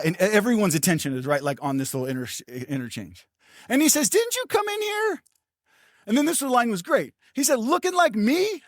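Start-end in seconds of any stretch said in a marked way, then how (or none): 4.1-5.2 lightly voiced young man, much higher; a hint of an excited young stranger asking; young guy. Young man in the waiting room greeting the pastor, higher, lightly voiced. 7.6-8.6 slow, much higher teasing question; lightly voiced young man, drawn out; young guy. Young man teasing the pastor about his looks, slow and higher.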